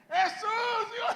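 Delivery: very high, all-out shout; gritando más fuerte. Acted. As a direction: yell desperately over those silencing you. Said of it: "comenzó a gritar más fuerte". (grito altísimo a todo pulmón)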